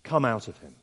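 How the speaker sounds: understated, low command; simple command. Retold or imitated. Jesus orders the demon out, simple command. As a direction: order it simply, without raising the voice. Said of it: "utters a simple command".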